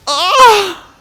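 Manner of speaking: sharp high gasp into a yelp; gasp, then cry out high. Real, not acted. Jump in game.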